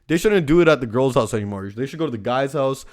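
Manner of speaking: ordinary talk, nothing marked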